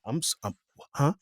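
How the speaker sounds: quiet, broken-off bewilderment; baffled stammer. Retold or imitated. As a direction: stumble over false starts, lost. Baffled reply to client's odd request.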